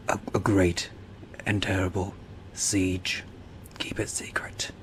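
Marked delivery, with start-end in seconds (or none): 3.8-4.7 hushed secret whisper. Pretend. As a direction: breathe it like a secret. Conspiratorial aside to viewers.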